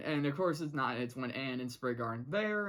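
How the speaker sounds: normal talking voice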